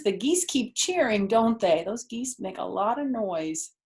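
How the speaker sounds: plain reading voice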